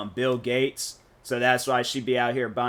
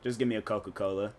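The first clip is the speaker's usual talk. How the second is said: usual speaking voice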